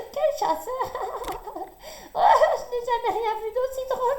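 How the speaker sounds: high laughing rabbit voice, fast; bursting with laughter. A narrator's reading. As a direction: laugh helplessly through the words. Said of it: "éclate de rire en se tenant les côtés". (petit lapin se moque de Hiawatha, éclat de rire)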